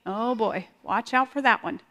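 ordinary talk

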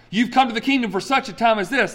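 preacher mocking the misquoted verse; popular misquote. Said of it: "That's not what Esther four eleven says".

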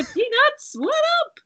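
very high, squealed hello; excited greeting. Acted. Invisible player going in for a high five, excited high greeting. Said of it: "But I'm gonna go".

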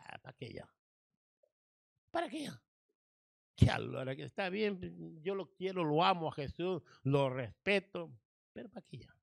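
soft, weary, defeated murmur; hopeless grieving sister. Acted. María telling Marta there is no point now, soft and defeated. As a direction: let each "para qué" sink, giving up.

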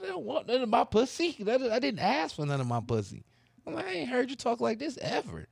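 high-pitched, put-out retort; incredulous protest. Retold or imitated. Man retelling his answer to his girlfriend, high-pitched and incredulous. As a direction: protest high in mock disbelief. Acts out his startled refusal to her.

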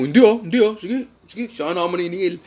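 high, flustered mock retreat; hammed-up nervous backing off. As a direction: backpedal fast, voice jumping up. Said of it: "sabi mo".

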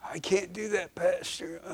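soft, hushed imitated church member turning the pastor down; quiet reluctant refusal. A reluctant member softly tells the pastor no, hushed. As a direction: mumble the excuse under your breath.

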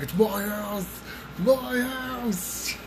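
high, drawn-out creepy demon call; high eerie mock voice; imitated demon.

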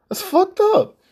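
sudden high, loud furious yell; furious outburst. Real, not acted. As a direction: explode at her, voice jumping up high and loud. Angry rant at ex-partner.

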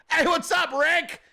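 high, eager fan greeting; over-friendly heckler voice; fake-sincere trolling. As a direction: hail him with put-on earnest friendliness. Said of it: "And you're sincere as fuck".

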